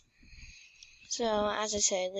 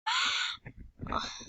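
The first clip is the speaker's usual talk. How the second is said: sharp gasp; 3 dB quieter than usual. Real, not acted. Gasp during game.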